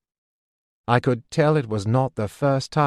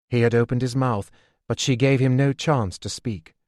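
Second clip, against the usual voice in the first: usual speaking voice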